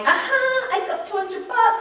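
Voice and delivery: high, drawn-out retold gloating; replayed glee at a win; gleeful crowing. Re-enacts celebrating her winning bet.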